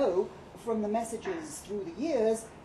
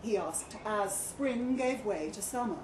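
ordinary delivery, nothing marked

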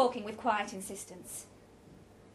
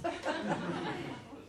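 light laugh; 4 semitones higher, 4 dB quieter than usual. Acted. Roger laughs at his joke.

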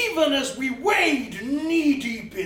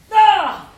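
sudden cry; 11 semitones higher, 15 dB louder than usual. Acted. Sudden outcry.

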